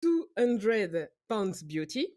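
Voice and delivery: slow, careful English accent from a French speaker; best English accent. Shown as a demonstration. Host unveils the film title to co-hosts, best English accent. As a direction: announce it grandly in your best English accent. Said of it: "mon meilleur accent anglais".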